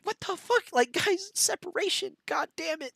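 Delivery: high pitched disbelief, pleading; exasperated. Real, not acted. Venting at religious voters, high exasperated pleading. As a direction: throw up your hands at them.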